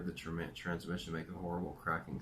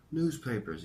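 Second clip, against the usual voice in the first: put-on higher-pitched New York accent; 6 semitones higher than usual. A narrator's reading. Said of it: "he said in his New York accent".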